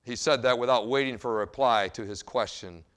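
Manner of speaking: ordinary delivery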